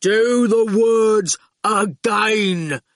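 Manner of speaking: very slow dim command; slow goon voice. A narrator's reading. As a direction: plod it out as an order. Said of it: "said the goon".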